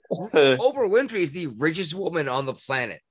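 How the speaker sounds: normal talking voice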